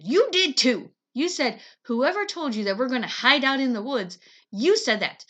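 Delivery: loud angry outburst; exploding. Acted. Brother explodes at sister, loud and angry. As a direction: blow up at his sister. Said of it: "Jamie exploded".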